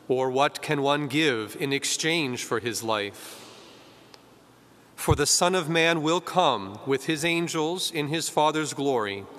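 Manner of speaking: measured, solemn liturgical proclamation; solemn Gospel reading. Acted.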